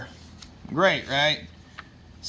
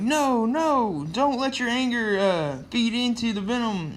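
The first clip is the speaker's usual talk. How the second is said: urgent plea; 8 semitones higher, 4 dB louder than usual. Acted. Flash begs Peter to stay calm.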